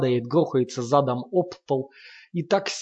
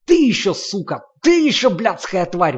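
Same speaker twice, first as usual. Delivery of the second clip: higher, louder howling insult; furious howl; 6 semitones higher, 8 dB louder than usual. A narrator's reading. Lomov howling insults at Sasha, furious howl. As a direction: hurl the insult at her. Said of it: "вопит Ломов".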